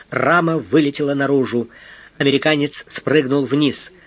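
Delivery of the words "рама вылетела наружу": ordinary delivery, nothing marked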